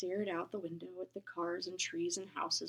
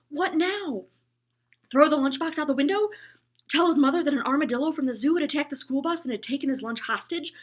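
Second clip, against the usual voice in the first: higher, louder worried rush; Henry's frantic thoughts, higher and louder; rising panic; 6 semitones higher, 10 dB louder than usual. A narrator's reading. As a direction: let the panic build.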